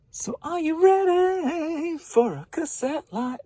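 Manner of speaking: very high, slow, loud hype call; hype announcer. Acted. Seller hyping viewers for the cassette lot. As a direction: stretch it out like a game show host.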